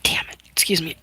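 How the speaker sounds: under-breath whisper; quick whispered excuse me; whispered aside. Real, not acted. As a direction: whisper an apology to yourself. Aside while tearing up.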